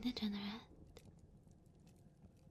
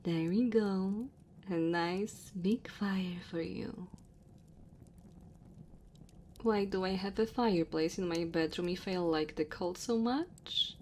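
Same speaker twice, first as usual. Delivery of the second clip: lightly voiced dragon woman, louder, measured delivery; 10 dB louder than usual. Dragon woman to her cold guest in the cave, gently apologetic. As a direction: play an apologetic dragon host at speaking volume.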